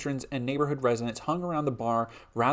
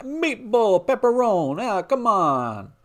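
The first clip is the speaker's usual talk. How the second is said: much higher, loud mock Italian accent, slow on come on; 9 semitones higher, 7 dB louder, much slower than usual. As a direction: ham up the Italian cliché to tease the complainer. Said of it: "stop doing stereotypical Italian accents".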